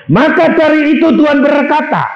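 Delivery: high, loud, slow pulpit proclamation; thundering high pulpit shout; preaching shout. Real, not acted. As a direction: proclaim it at full force.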